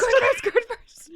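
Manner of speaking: shrieked through laughter, high and loud; laughing shout. Retold or imitated. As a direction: yell it while cracking up. Echoing the mother's nagging, laughing.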